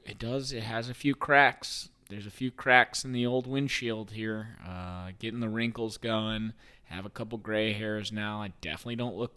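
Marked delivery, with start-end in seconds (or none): none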